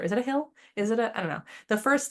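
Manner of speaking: ordinary delivery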